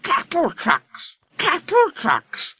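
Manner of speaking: very high, slow rhythmic grumble; grumbling coaches. A narrator's reading. Coaches grumbling at being called cattle trucks. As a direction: chant it like wheels on rails. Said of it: "grumbled the coaches".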